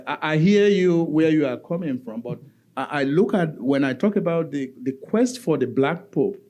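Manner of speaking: ordinary talk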